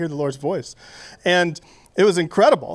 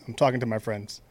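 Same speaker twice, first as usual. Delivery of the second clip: ordinary talk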